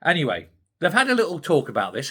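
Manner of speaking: ordinary talk, nothing marked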